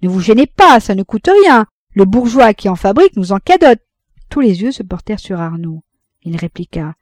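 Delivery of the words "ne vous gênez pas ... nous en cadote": loud mocking shout across the table; cried out. A narrator's reading. La débardeuse s'écrie vers Arnoux, moqueuse et bruyante. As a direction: yell it gleefully at the party. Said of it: "la débardeuse s'écria".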